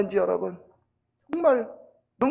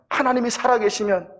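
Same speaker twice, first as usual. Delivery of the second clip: put-on children's protest, rising in pitch and force; mildly acted indignant complaint; complaining; 3 semitones higher than usual. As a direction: build a retold grievance toward the last question. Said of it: "불평하기 시작하는 거예요".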